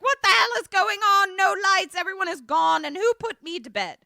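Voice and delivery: high, loud, indignant confusion; bewildered outburst. Retold or imitated. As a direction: act out waking baffled and shouting questions. Acts out Peggy waking in the dark.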